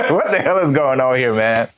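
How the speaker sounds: amused, incredulous retelling; laughing disbelief. Real, not acted. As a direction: laugh it out, baffled by the memory. Laughs while retelling his shock.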